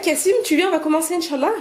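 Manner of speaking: mother calling her son to start the lesson, high calling voice. Real, not acted.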